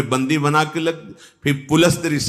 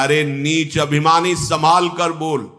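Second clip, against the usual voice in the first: mildly angry rebuke climbing into raised proclamation; Angad's indignant anger; 6 semitones higher than usual. Acted. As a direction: declaim in righteous indignation, settle on the close. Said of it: "Angad जी को आप क्रोध आया". (अंगद का हल्का क्रोध भरा, जोशीला उद्घोष)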